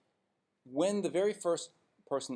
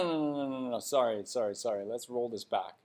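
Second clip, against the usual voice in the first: ordinary talk, nothing marked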